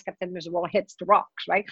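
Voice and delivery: ordinary talk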